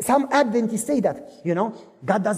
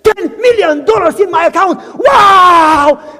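high, loud cry of joy ending in a wow; overjoyed at the screen; ecstatic shout; 7 semitones higher, 11 dB louder, slower than usual. Acted. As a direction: euphoric yell. Sees money in account.

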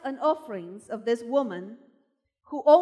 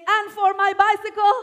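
higher, louder plea; echoing the child's cry; 7 semitones higher, 7 dB louder than usual. Retold or imitated. A believer left out of a testimony meeting, mock child's plea. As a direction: lift it like the girl's cry again, lighter. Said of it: "you're saying".

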